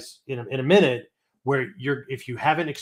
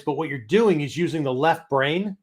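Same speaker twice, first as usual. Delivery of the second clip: ordinary talk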